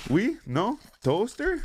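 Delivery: high-pitched, rising one-word questions; mocking questioning. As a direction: imitate her teasing, each word a separate high query. Girl's mocking question, acted out.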